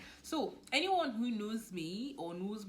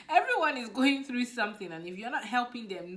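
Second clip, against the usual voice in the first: louder, pressing the point; emphatic; 6 semitones higher, 13 dB louder than usual. Real, not acted. Vlogger lecturing viewers, loud and emphatic. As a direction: push the message out loud.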